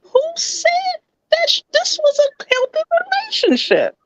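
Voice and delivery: very high, wailing celebrity impression; Portia Williams. Co-host questioning a healthy relationship, mock-outraged impression. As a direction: squeal the question in put-on disbelief, dropping at the end. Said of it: "in my Portia Williams voice".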